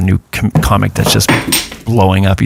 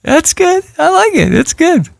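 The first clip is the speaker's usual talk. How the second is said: falsetto praise. Pretend. Mock praise of bad joke.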